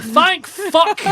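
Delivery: loud, high burst of relief; relieved shout. Retold or imitated. Pal relieved the groom never heard him, loud. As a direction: blurt out thank fuck with relief.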